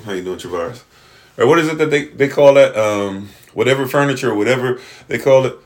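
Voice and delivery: ordinary talk, nothing marked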